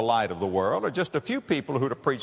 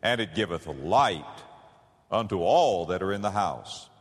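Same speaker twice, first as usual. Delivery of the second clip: scripture reading; 4 semitones lower than usual. Acted. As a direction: read the verse with reverent weight. Reads scripture in sermon.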